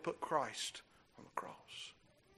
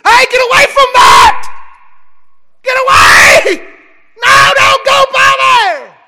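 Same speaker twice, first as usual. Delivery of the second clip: high, loud shout, slower on "Get away!"; frantic yell; 23 dB louder, slower than usual. Pretend. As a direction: yell to stop the child by the fire. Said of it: "No, what do you do?".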